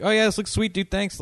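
high, easy-going quote of a chill rocker; laid-back musician, lightly imitated; mellow rocker. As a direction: toss it off casually, like nothing fazes him. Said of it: "It was just, like, mellow".

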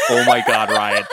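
loud, laughing disbelief. Real, not acted. Reader breaks up over the Daddy Hunt line, laughing. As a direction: crack up while saying it.

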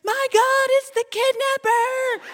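high, loud fake panic cry, slowed at the end; mock alarm. Peggy fakes terror to scare the little kids. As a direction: scream it like you've seen the kidnapper. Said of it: "she's like".

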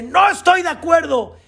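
high, forceful protest; refuse, shouting; man shouting his refusal at the angel of death; defiant shout. Acted. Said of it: "empieza a gritar y dice".

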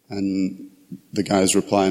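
ordinary talk

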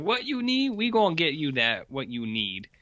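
a touch of Cajun as Lincoln, easygoing; slight Cajun accent. Retold or imitated. Co-host voices Lincoln with a light Cajun accent. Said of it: "this slight Cajun accent now".

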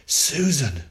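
loud, high, frightened call; father crying out in fear as he reaches her; fearful cry. A narrator's reading. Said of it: "he cried, trembling with fear".

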